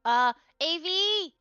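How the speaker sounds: loud, drawn-out call across the room. Real, not acted. Calling for A V.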